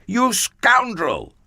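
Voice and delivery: high, slow indignant shout; outraged cry. Acted. Jacob crying out at tricky Laban, high and slow. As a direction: fling the insult slowly. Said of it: "he cried".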